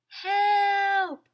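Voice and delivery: high, far-off muffled call; muffled cry for help. A narrator's reading. Trapped man calls again.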